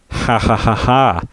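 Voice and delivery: gloating cartoon villain laugh. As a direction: cackle in mock triumph. Gloating about victory and power.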